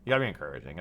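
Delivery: ordinary talk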